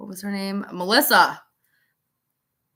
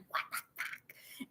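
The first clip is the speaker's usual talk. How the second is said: slow whisper of shock; whispered disbelief; 20 dB quieter, slower than usual. Retold or imitated. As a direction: breathe it out in stunned disbelief. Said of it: "They're just like".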